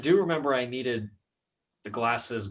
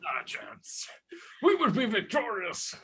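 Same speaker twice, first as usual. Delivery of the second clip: mock German accent, laughing; half-joking German voice; 9 semitones higher than usual. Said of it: "Your German accent?".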